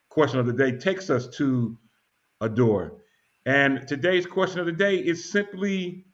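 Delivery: normal talking voice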